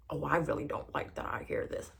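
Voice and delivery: low mock English accent; badly imitated English accent; attempted English accent. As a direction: put on a little English voice, low and rough-and-ready. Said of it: "his little English accent".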